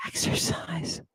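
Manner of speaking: "Exercise" whispered, unvoiced and soft; hushed whisper of "Exercise". Shown as a demonstration. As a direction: breathe the word "Exercise" as a secret.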